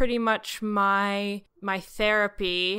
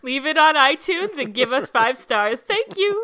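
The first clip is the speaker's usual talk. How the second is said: mock podcast plug; 4 semitones higher, 7 dB louder than usual.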